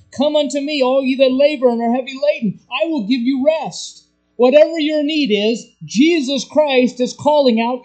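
loud, high altar call; climactic preaching call. Acted. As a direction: call them all to come. Climax of sermon, loud calling.

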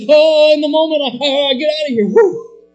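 loud, high, fast mock panic; panicked deserter. Preacher acting out a disciple fleeing, loud and fast. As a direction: stammer it like a man bolting. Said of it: "Oh, forsaking is".

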